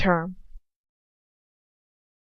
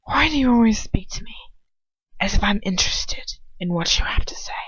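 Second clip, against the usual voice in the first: tight, contemptuous whisper; suppressed exasperation; 2 semitones higher than usual. Acted. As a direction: sneer it under your breath. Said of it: "with suppressed exasperation as he interrupts him".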